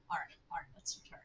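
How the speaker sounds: unvoiced whisper to herself. Real, not acted. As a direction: whisper it under your breath. Muttering to self while reading.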